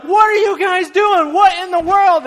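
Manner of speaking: high, loud outraged homeowner yell; angry yelling. Retold or imitated. Homeowner yells up at men tearing his roof. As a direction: demand answers at the top of your voice. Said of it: "the homeowner's just yelling".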